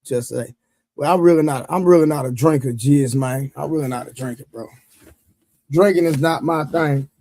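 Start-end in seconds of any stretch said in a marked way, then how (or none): none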